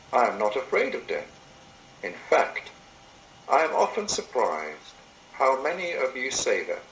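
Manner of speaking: normal talking voice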